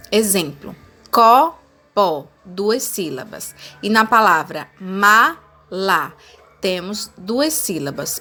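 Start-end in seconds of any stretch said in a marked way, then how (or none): 1.1-2.3 copó split into two separate beats; syllable by syllable. Shown as a demonstration. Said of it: "Podemos dividir as palavras em sílabas". 4.8-6.2 mala slowly split as ma, la; two separate beats on mala; syllable by syllable. Shown as a demonstration. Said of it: "Podemos dividir as palavras em sílabas".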